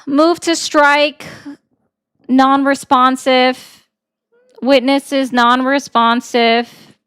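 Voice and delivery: slow, measured courtroom declaration; playing attorney, deliberate and emphatic; mock lawyer objection. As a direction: object formally, drawing out each word.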